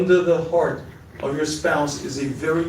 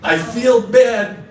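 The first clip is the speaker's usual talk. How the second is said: slow, high, loud whiny I feel bad; a fake-sorry spouse setting up an excuse; mock half-apology; 6 semitones higher, 6 dB louder, much slower than usual. Shown as a demonstration. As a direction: drag out a grudging apology. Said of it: "Don't ruin a good apology with a".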